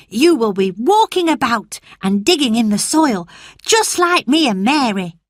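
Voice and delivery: a lively country lad reassuring his friend; cheerful boy Dickon. Acted.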